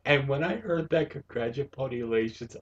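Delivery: normal talking voice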